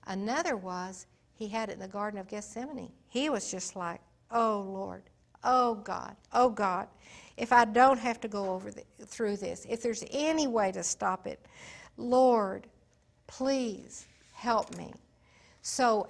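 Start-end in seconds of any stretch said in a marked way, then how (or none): 4.3-14.9 louder, desperate pleading prayer; anguished plea. Acted. Jesus in Gethsemane begging God to spare him, anguished. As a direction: beg God to take this away.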